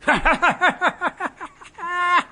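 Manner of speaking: high, loud mocking laugh. Captain laughing at the man who staged the theft. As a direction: laugh at the whole charade.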